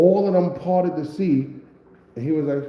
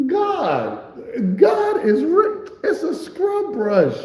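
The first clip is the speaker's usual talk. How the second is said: high, slow shout of relief; excited shout; 14 semitones higher, much slower than usual. Retold or imitated. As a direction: cry out at the find, way up high. Relieved shout on finding weapon.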